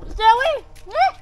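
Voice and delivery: high, loud urgent shout; sharp shouted warning. Real, not acted. Warning child about a bee.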